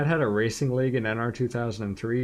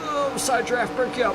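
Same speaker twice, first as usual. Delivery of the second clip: excited; 12 semitones higher than usual. Real, not acted. Side drafting battle.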